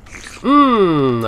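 usual speaking voice

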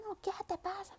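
high, sharp mock retort to a father; a child mouthing off to God, mock rude. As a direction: snap back rudely, half-acted. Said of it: "no gritándole".

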